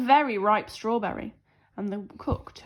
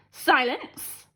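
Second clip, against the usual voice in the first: cried out; 4 semitones higher, 6 dB louder than usual. A narrator's reading. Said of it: "cried Princess Lolly".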